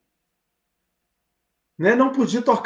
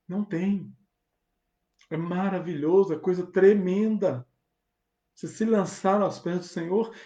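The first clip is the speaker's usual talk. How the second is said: ordinary talk, nothing marked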